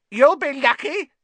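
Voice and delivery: very high, loud gleeful cackle of a voice; mischievous spirit mocking; cackling poltergeist. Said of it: "said a cackling voice".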